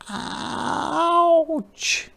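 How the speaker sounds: high squeaky hedgehog cry; a tiny pained squeak. A narrator's reading. Hedgehog yelps as the apple hits her back, high squeak. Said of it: "squeaked Hedgehog".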